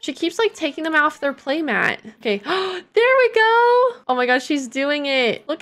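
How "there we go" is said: high, loud, slow delighted squeal; excited squeal. Real, not acted. Baby finally grabs rattle.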